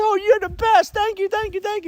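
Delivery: put-on high, breathy-free falsetto girl voice gushing thanks; gushing woman. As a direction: squeal it like a grateful young woman. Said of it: "And she goes".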